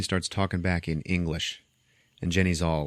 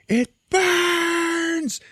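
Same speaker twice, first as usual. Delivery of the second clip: very high, drawn-out mock scream; agonized scream; 4 dB louder than usual. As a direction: wail it in hammed-up agony. Mummy in agony from mercury.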